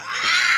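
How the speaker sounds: high excited "ah!" scream; re-enacted fan scream. Fan showing his reaction to the landing, high excited scream. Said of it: "that was my reaction".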